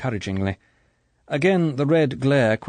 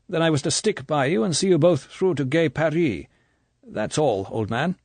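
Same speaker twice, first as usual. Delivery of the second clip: a light Irish brogue, a little higher; hint of brogue; 7 semitones higher than usual. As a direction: Nash speaking with a faint cheap brogue. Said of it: "A hint of brogue".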